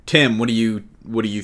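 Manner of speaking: normal talking voice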